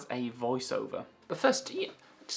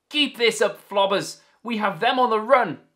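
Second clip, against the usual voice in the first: high, loud gleeful shout; delighted yell; 7 semitones higher, 6 dB louder than usual. Acted. Captain cheering his crew on, loud delighted yell. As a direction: yell with joy mid-battle. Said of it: "yelled Solomon Clegg with great delight".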